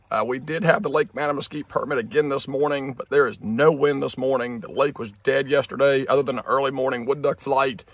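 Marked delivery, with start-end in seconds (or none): none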